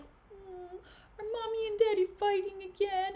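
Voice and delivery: high, babyish put-on voice; mock little kid. Iago asking if mommy and daddy are fighting, mock childish voice. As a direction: play a child asking about the parents' row, hammed up.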